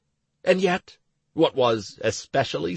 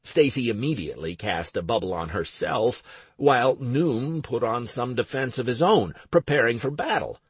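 plain reading voice

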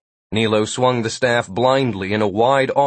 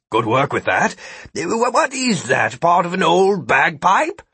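character voice jumping high on the stammered question; praise, then query it in a high, baffled voice; Gilbert, puzzled; 7 semitones higher than usual. A narrator's reading. Gilbert asking Vikram about his odd flute, puzzled.

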